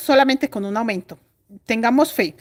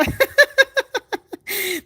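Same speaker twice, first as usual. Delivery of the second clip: laugh; 9 semitones higher than usual. Real, not acted. Laughing at Nancy's comment.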